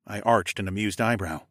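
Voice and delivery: ordinary delivery, nothing marked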